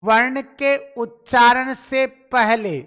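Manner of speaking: slow and deliberate, word by word; slow, for dictation. Shown as a demonstration. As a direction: dictate it slowly so they can write. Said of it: "ध्यान से सुनिएगा". (लिखवाने के लिए धीमे-धीमे)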